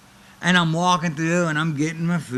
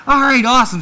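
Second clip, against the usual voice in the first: enthusiastic approval; 10 semitones higher, 9 dB louder, faster than usual. Retold or imitated. Boss approving the story.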